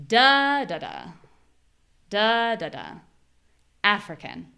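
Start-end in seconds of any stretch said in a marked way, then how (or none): 0.1-2.9 DA da da; stress on first da. Shown as a demonstration. Teacher tapping out the rhythm.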